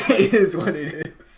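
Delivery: high, loud, drawn-out laughing repeat; slow, amused echo through real laughter. Real, not acted. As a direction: crack up while parroting a catchphrase. Laughing echo of friend's catchphrase.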